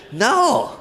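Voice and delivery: loud, high exclamation; emphatic no. Real, not acted. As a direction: call it out to wake the room. Answering his own rhetorical question emphatically.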